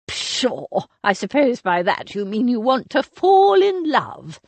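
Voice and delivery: put-on imperious elderly aunt, scornful and sharp; a grand dame dismissing the girl's excuse; haughty old aunt. Aunt Agatha lecturing the girls.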